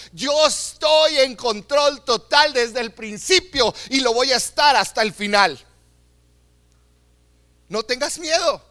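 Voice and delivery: ordinary talk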